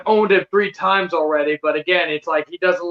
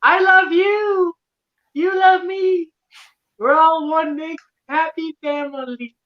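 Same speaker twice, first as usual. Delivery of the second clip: high, sugary preschool teacher voice; [name] impression; 8 semitones higher than usual. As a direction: coo it like a creepy kids' host. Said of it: "He sounds like a preschool teacher".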